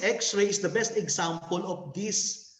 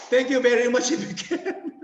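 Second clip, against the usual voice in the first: laughing; 5 semitones higher than usual. Real, not acted. Laughs nervously mid-presentation.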